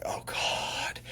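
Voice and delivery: whispered groan of dread. Retold or imitated. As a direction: breathe it out like a doomed recruit. Trainees dread Screaming Eagle day.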